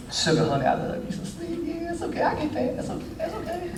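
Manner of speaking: hushed, high mock sweet-talk; tiny high voice, mock infatuation; lovey-dovey sweethearts. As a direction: coo softly like a hammed-up honeymooner. Mimics sweethearts in dating stage.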